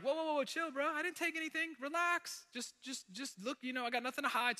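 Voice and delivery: high, defensive protest; whoa, chill, bro, I didn't take it; nervous brother. Retold or imitated. Brother denying theft to the guards, high and defensive. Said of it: "the brother's like".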